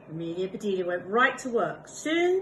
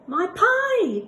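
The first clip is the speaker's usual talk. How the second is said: loud, much higher cry of alarm; sudden exclamation; 12 semitones higher, 11 dB louder than usual. Acted. Amelia Bedelia remembering her pie, alarmed loud cry. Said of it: "exclaimed Amelia Bedelia".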